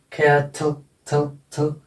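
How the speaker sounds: कैटल ending in a barely voiced schwa plus L; teacher repeating the final थल as a short syllabic L; faint schwa demo. Shown as a demonstration. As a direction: isolate थल from कैटल, vowel only lightly sounded. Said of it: "इसकी थोड़ी थोड़ी आवाज आ रही है".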